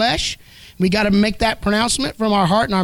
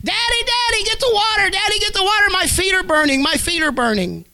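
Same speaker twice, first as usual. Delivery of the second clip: high, desperate cries, fading toward the end; a dying girl screaming for water; screaming in terror; 11 semitones higher than usual. Retold or imitated. Dying girl screaming to her daddy, high terrified cries. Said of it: "he began to hear her scream".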